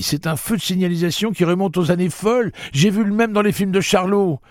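high, loud, worked-up rant; excited high-pitched outcry; exclaiming. A narrator's reading. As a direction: protest loudly at the top of your range. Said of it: "s'écria Eddie".

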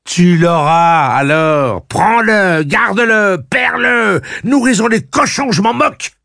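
high, loud, drawn-out taunting shouts; enraged, stretched-out jeering yell; furious, with angry smile. A narrator's reading. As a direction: hurl each phrase at her in rage. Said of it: "avec un sourire rageur".

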